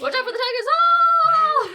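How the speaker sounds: high, loud parrot squawk ending in a drawn-out cry; Bones-mimicking macaw. Macaw repeats its owner's tiger warning, high loud squawk. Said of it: "so they kinda sound like Bones occasionally".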